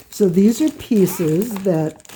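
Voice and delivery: normal talking voice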